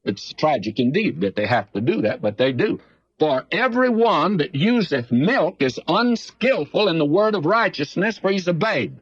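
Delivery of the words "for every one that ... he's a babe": scripture reading. Acted. Reads scripture aloud.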